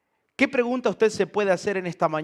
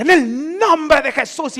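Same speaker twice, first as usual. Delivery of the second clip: much higher, louder, slow commanding cry; mock shout of the name to scare off a dog; shouted rebuke; 10 semitones higher, 11 dB louder than usual. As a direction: bellow it at the charging dog. Parishioner shouting the formula at dog.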